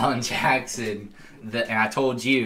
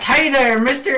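high-pitched, loud mock hail; imitated friendly greeting; 14 semitones higher, 5 dB louder than usual. Imitating Arthur greeting a townsperson, friendly hail. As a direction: call out to a passing stranger, playfully acted.

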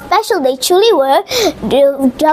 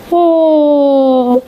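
long, gently falling hmm; thinking hum. Acted. Wise owl thinks it over, long pondering hum.

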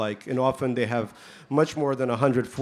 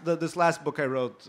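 normal talking voice

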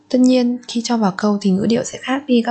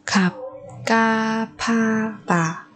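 slow, softer drawn-out repeat; slow soft drill; 4 dB quieter, slower than usual. Shown as a demonstration. Teacher to students, slow soft repeat. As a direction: ease through each syllable gently. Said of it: "teacher repeats word slowly".

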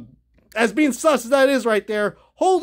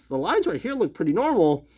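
ordinary talk, nothing marked

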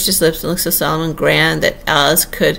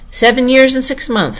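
higher, musing echo of the age; thoughtful repetition; 6 semitones higher, 4 dB louder than usual. A narrator's reading. Humpty Dumpty pondering Alice's age, thoughtfully. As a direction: weigh it aloud with raised pitch. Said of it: "repeated thoughtfully".

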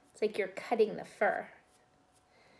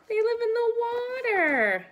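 excited; 12 semitones higher, 5 dB louder than usual. Acted. Answering quiz for children.

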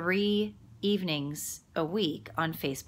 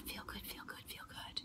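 whispered mantra; 19 dB quieter than usual. Acted. Repeating the mantra to viewers, whispering. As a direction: chant fading to a breathy unvoiced whisper. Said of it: "mantra fading to whisper".

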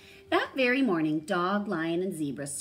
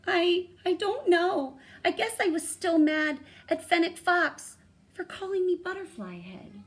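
high, loud nervous stammer; quavering voice; 14 semitones higher, 7 dB louder than usual. A narrator's reading. Dog confesses to the ghost with a quavering voice. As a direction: let the voice tremble. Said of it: "Dog's voice quavered".

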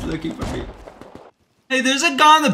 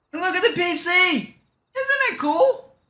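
mock excited; 8 semitones higher than usual. Showing off his PC.

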